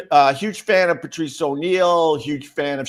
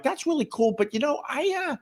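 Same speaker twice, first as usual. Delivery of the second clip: 1 high mock reply. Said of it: "I'm like".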